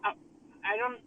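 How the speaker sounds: normal talking voice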